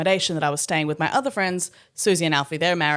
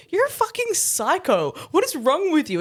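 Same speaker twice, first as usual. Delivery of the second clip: disbelief at a friend; hammed-up scandalized voice; mock outrage; 11 semitones higher than usual.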